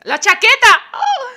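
high, loud excited cry; excited outburst. Real, not acted. Streamer picking an outfit for the date, excited high cry. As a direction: blurt it out thrilled at the jacket.

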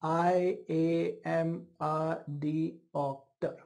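imitating a cerebellar patient; scanning speech demo. Shown as a demonstration. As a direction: space out I am a doctor in separate beats. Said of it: "So how will this patient talk?".

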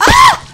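very high, loud shriek; startled scream. Real, not acted. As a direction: scream at a sudden hit. Hit suddenly in game.

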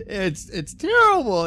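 exasperated groan. Real, not acted. Fed-up reviewer groaning at the plot twist, exasperated. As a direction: drag out "terrible" as if the plot physically pains you.

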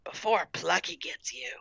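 very soft, hushed threat; whispered. A narrator's reading. Gertrude scaring Mandy indoors, whispering. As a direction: whisper it as a spooky warning. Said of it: "Gertrude whispered".

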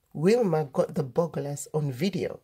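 plain reading voice

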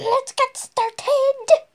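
high, slow, sing-song announcement; mock grand intro; silly high voice. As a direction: stretch it out in a goofy high voice.

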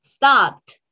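"stopped" said loudly as "stopt"; final t sound. Shown as a demonstration. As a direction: release a crisp "t" at the end of "stopped". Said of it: "Vaya repitiendo ahí".

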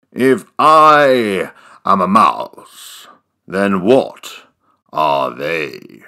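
low, slow, descending; deep voice. Acted. Mouse asks in deep voice.

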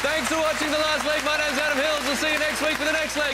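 high, loud, shouted farewell; loud sign-off. Real, not acted. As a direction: send them off big. Host signing off loudly.

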